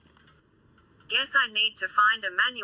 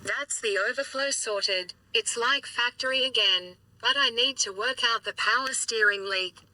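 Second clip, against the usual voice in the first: robot voice. Real, not acted. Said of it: "you're gonna have to put up with my robot voice for a bit".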